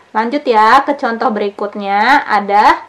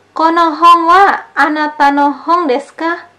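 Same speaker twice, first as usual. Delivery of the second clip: Kono hon wa anata no hon desu ka asked higher and louder; dialogue question; 6 semitones higher, 4 dB louder than usual. Shown as a demonstration. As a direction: voice the asker, raised and bright. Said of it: "contoh kalimatnya". (membacakan pertanyaan dialog dengan suara lebih tinggi)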